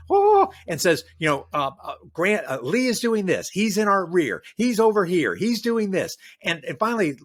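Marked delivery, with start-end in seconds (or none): 0.1-0.5 a sudden high, loud yelp of alarm; imitating a panicked officer's gasp; alarmed cry. Officer rushing up to Grant, alarmed high cry. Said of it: "again, hair afire". 2.1-6.2 higher, louder, breathless rush of mock panic; hammed-up frantic officer reporting on Lee; panicked report. Frantic officer warning Grant about Lee, mock panic. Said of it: "somebody comes up to him, again, hair afire".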